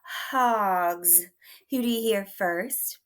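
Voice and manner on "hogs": hogs clapped out in one beat; clapped syllable. Shown as a demonstration. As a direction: clap it once with the word. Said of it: "One more time".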